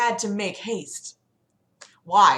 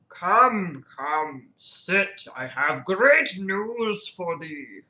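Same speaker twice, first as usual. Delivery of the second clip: put-on slow, stately Old English welcome; ancient talking tree; much slower than usual. Deku Tree invites visitors to sit, slow and stately. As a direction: beckon slowly, drawing out the words. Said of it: "Deku Tree speaks in Old English".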